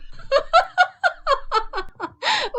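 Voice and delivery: high-pitched drawn-out laugh; laughter. Acted. Woman laughs at a man's confession of attachment, high laughter. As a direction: burst into a long, high staccato laugh. Scornful reply to his confession.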